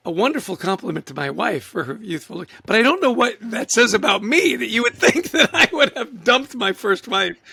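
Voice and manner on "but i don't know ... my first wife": laughing. Real, not acted. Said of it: "laughing at the idea".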